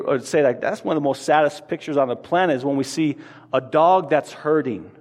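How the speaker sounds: ordinary talk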